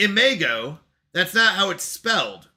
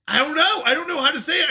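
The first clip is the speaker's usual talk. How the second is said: exasperated; 10 semitones higher, faster than usual. Real, not acted. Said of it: "exasperated about pronunciation".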